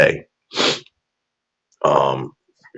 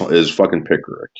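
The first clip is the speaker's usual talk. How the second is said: ordinary talk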